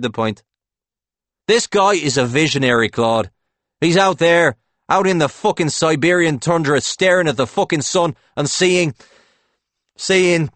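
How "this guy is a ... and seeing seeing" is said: loud, high-pitched fervour; excited raving. A narrator's reading. Howie hailing his visionary to Claude, fervently excited. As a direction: rave like a true believer.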